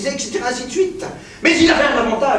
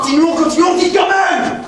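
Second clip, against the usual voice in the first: much higher and loud, hammering; raging shout; 7 semitones higher, 4 dB louder than usual. Acted. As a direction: force her onward by yelling. Professor escalating, shouting.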